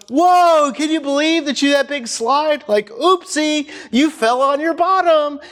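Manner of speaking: put-on high, bright sing-song to a toddler; playful mock surprise. Shown as a demonstration. Preacher showing how to joke with a fallen toddler, high playful sing-song. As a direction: make the fall into a joke. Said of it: "You play off of it. You're like".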